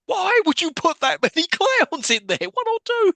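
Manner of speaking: squeaky disbelieving rant; exasperated disbelief. Real, not acted. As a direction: rant in high outrage at the clowns. Ranting at clown-filled room.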